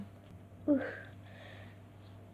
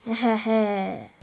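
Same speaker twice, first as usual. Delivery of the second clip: evil low chuckle; 4 semitones lower than usual. Acted. Villain chuckles.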